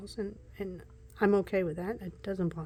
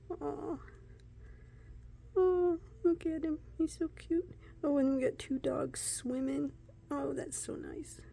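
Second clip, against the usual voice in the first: much higher cooing over something cute; 7 semitones higher than usual. Real, not acted. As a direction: coo like at a puppy.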